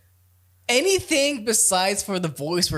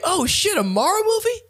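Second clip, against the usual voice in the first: high, excited disbelief; excited fan; 14 semitones higher than usual. Retold or imitated. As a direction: gasp it like a thrilled fan. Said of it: "People are gonna be like".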